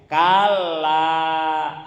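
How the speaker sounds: loud, solemn, drawn-out refusal; voice of Allah refusing. Acted. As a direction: pronounce judgment with weight, stretching it out. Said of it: "kata Allah".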